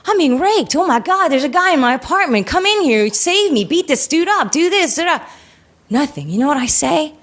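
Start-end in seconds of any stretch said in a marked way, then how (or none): 0.1-5.2 mock screaming. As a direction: imitate frantic screaming without raising the volume. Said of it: "you would start screaming".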